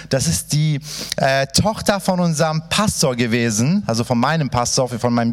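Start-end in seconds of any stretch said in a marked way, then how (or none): none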